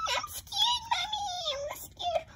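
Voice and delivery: very high, crying little doll voice; scared doll voice. Pretend. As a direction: whimper it like a frightened toy child. Doll scared in power cut.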